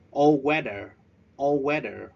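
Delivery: all WEAther, stress on the second part. Shown as a demonstration. Teacher modelling the word: stress on weather. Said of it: "we stress at the second syllable".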